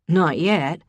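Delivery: lightly voiced man, teasing; casual male drawl; Ryan's voice. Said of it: "Not yet," implying that he wanted them".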